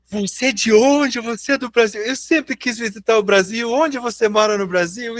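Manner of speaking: voz mais aguda e empolgada, imitando o americano; americano animado. Retold or imitated. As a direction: imite alguém curioso e animado. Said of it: "sempre fica animado". (imitação de americano empolgado com o Brasil)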